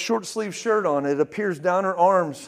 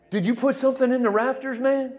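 imitated incredulity; 6 semitones higher than usual. Voicing incredulous person in the room.

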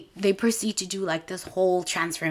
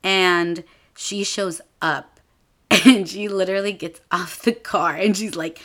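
usual speaking voice

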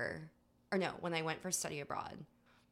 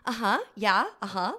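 loud bright uh-huhs, nodding along; fake agreeing; 4 semitones higher, 9 dB louder than usual. Nodding along to Scottish men she can't understand, loud fake agreeing. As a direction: pretend to follow what you can't hear. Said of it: "nodding my head and going".

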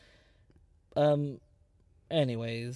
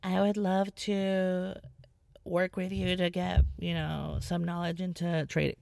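slow, higher sugary sales voice; mocking a slow scam sales pitch; mock boss babe pitch; 4 semitones higher than usual. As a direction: drag out a fake friendly pitch like a scammer. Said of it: "it's like the boss babes".

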